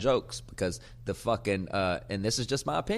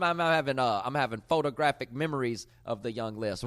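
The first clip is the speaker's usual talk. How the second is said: ordinary talk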